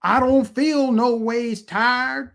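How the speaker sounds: high, slow put-on preacher drawl; a mocking impression of Hillary Clinton, high and slow. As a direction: mimic a politician faking a dialect. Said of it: "You guys remember that?".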